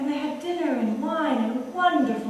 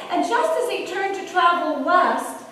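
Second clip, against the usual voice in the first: ordinary delivery, nothing marked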